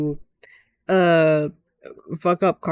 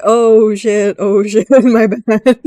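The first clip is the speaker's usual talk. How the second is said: loud, laughing mock panic, pitched up; mock loud oh shit; 3 semitones higher, 9 dB louder, much slower than usual. Voicing the boss's flip apology, a loud laughing mock yelp. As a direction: blurt it out half-laughing, hammed-up.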